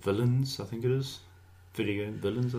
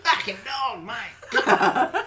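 Aussie ad-lib; 9 semitones higher, slower than usual. Pretend. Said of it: "Australian ad-libs?".

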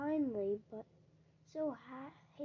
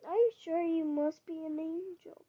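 put-on child crying out; 4 semitones higher, 5 dB louder than usual. A narrator's reading. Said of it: "cried the child".

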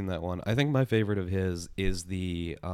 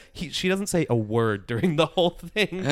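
talking through laughter, pitched high; laughing; 7 semitones higher, 4 dB louder than usual. Real, not acted. Friend cracking up while retelling the sketch, laughing speech. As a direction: laugh your way through the line.

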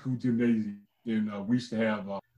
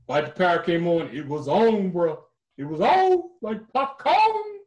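hyped up; 6 semitones higher, 3 dB louder, slower than usual. Real, not acted. Hyped recalling the dance.

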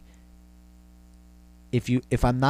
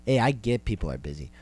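ordinary talk

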